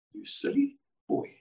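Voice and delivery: a breathless whisper, half to himself; whispered rebuke. Retold or imitated. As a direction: hiss the inner rebuke softly. Inner voice rebukes him.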